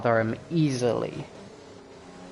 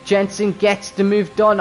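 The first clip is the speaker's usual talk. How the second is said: excited call; 8 semitones higher, 5 dB louder than usual. Acted.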